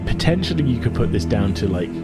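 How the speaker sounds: usual speaking voice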